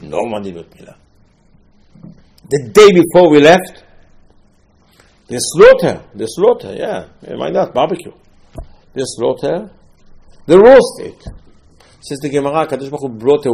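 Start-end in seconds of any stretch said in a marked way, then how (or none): 2.5-11.2 excited. Acted. Preacher excitedly describes the barbecue.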